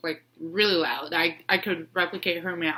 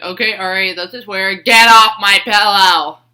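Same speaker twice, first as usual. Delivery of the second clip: firm scolding; 12 dB louder than usual. Retold or imitated. Scolding the cat off her pillow, loud and stern. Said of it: "I was like".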